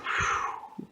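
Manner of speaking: voiceless blown-out "phew"; sigh of relief. Acted. Sidekick relieved she only grabbed gum, soft blown "phew". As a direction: blow out a soft breath of relief. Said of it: "Robin said".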